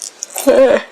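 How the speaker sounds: big sleepy yawn. Real, not acted. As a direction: let out a long yawn. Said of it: "Sorry, I keep yawning".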